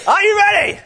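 all-out hype yell; bigger rally shout. Shown as a demonstration. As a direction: push it further for the crowd. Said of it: "there needs to be a little bit more".

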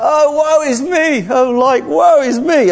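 high, slow wailing lament; crying out. Acted. God lamenting in Genesis, crying out high and drawn out. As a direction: cry it out in hammed-up anguish. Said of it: "crying out".

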